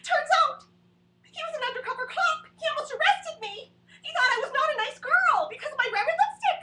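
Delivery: high, loud and excited; comic building to the big reveal, high and loud; big punchline. Acted. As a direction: belt out the payoff.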